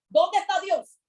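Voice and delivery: José shouting his complaint in the pit; mock outcry. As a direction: cry it out to heaven. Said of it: "podía haberse quejado en ese hueco".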